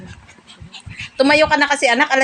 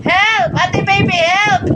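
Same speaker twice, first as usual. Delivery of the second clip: pretend cry for help; 8 semitones higher, much slower than usual. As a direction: cry out as if falling, all in fun. (malakas na kunwaring sigaw ng tulong)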